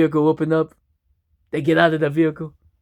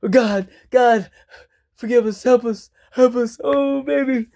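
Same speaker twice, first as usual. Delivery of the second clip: panicked prayer; 8 semitones higher than usual. Acted. Husband praying before cliff, frantic high voice. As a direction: plead with God for help.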